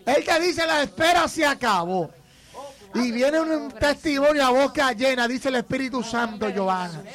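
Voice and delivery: fervent, insistent prophetic proclamation; prophetic preaching. Real, not acted. Pastor promising Joana a testimony, fervent preaching. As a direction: drive each promise home with conviction.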